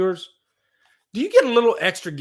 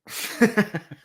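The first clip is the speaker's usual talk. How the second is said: amused burst of chuckling; laugh; 6 semitones higher than usual. Real, not acted. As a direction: laugh at your own bit. Laughs after saying magic.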